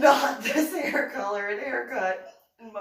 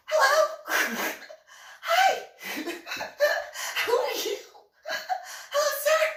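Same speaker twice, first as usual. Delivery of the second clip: high, loud, bubbling hello; giddy excited greeting; 4 dB louder than usual. Retold or imitated. Private meeting the general, high excited gushing. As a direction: gush at the general like a starstruck fan. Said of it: "why are you so excited?".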